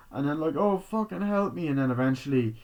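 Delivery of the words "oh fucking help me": desperate plea. Retold or imitated. Begging after injury.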